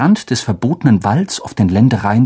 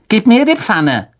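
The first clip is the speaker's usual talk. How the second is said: greedy son; 10 semitones higher than usual. Acted. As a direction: bellow for more like a spoiled brat. Son demands pan.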